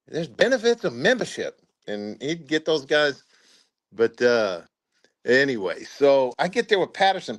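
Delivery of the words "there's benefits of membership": somewhat higher, a light smug put-on voice; a knowing little pitch; imitating the first sergeant. First sergeant recruiting members, lightly raised smug voice.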